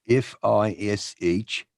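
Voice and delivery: slow attempt at Kiwi letters; careful imitation Kiwi spelling-out; New Zealand accent. Shown as a demonstration. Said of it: "perform with a New Zealand accent".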